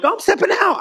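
high, eager announcement; bold declaration. Acted. Preacher mimicking someone taking a big step of faith, high and eager. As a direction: jump up in pitch, acting out a confident leap.

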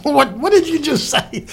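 high-pitched disbelieving question, imitated; incredulous sister. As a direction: mimic a stunned sister, voice shooting way up. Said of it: "she looked at me like".

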